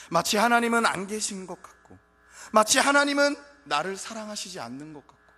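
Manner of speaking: loud, high, impassioned preaching; preaching at full force. Acted. Preacher crying out to the congregation, full force. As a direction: cry it out to the congregation.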